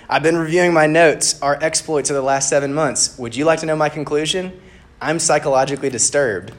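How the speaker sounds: lightly acted exasperation; bickering. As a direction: lay out a grievance with mock formality. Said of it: "they're bickering with each other".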